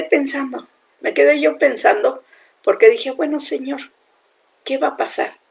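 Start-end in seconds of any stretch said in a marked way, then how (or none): 3.1-5.4 softer, a bit higher, asking God quietly; hushed worried prayer. Retold or imitated. Preacher retelling her quiet worried question to God about the pandemic. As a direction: murmur it like a private worry.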